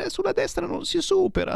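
sudden high outraged pitch; high mock-shocked correction; indignant aside. Real, not acted. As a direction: jump way up as if scandalised. Objects to overtaking on the right.

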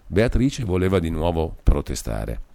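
ordinary delivery

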